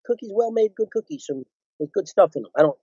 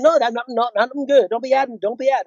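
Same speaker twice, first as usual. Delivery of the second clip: mock director voice; 8 semitones higher than usual. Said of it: "I said".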